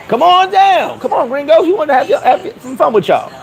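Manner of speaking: high, hammed-up come-on; mock local inviting tourists, pitched high; mock luring local.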